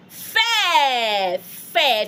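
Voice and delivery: "Fair" as feh, stretched, no R or T; French pronunciation drill. Shown as a demonstration. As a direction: open vowel held in "Fair", falling. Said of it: "You say what?".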